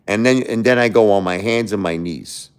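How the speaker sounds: ordinary talk, nothing marked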